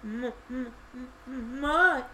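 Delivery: high, slow, frightened stutter. A narrator's reading.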